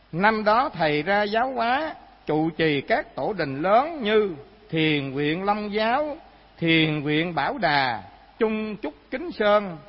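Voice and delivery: slow, emphatic reading aloud; recited sacred biography. A narrator's reading. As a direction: read it out deliberately, phrase by phrase.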